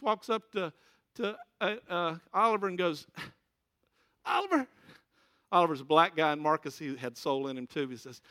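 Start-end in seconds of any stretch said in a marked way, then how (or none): 4.3-4.7 calling out. Retold or imitated. Marcus hails his friend Oliver, loud high call. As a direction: call the name high and loud across the room.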